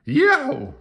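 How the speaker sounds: high loud frightened yelp; lady's scared yell. A narrator's reading. Lady opening door to a ghost, scared yell. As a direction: shriek at the ghost on the porch. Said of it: "she yelled".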